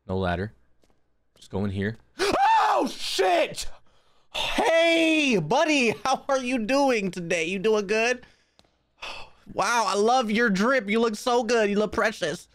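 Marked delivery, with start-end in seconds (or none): 2.2-3.7 gasp, then high shrieked curse; startled scream. Real, not acted. Jump scare in the game. 4.3-12.5 high, gushing put-on friendliness; nervous sweet-talk. Sweet-talking a scary game figure.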